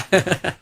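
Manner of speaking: short suppressed chuckle; sly little acted laugh; sniggering to himself. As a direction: snigger under your breath. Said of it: "गालातल्या गालात हसू लागला".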